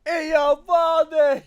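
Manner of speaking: high, loud, slow ballpark announcer; announcer call. Pretend. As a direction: call the name like a stadium announcer. Announcer-style name call.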